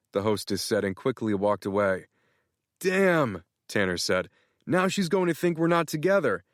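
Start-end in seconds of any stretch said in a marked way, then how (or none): 2.8-3.4 much higher, gushing excited friend; excited Tanner. Acted. Tanner thrilled, congratulating Matt on being in love. 4.7-6.4 much higher, gushing excited friend; excited Tanner. Acted. Tanner thrilled, congratulating Matt on being in love.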